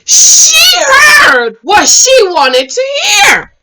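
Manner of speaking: high, slow, drawn-out cry; loud disbelief, stretching the words; exasperated exclamation. Real, not acted. Exasperated comment on Shay.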